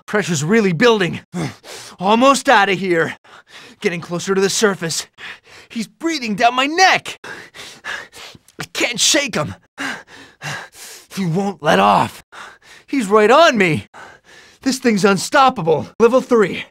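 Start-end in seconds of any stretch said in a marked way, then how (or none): 5.7-13.9 breathless, raised-pitch alarm; panicked. Acted. Hero climbing with foe right on him, breathless panic. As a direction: blurt it between pants, under pursuit. Hero closely chased upward.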